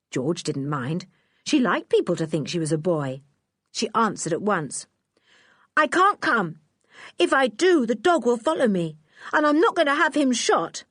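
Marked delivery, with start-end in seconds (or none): 5.8-10.8 put-on defiant girl. George refusing Lou to protect her dog, defiant and high.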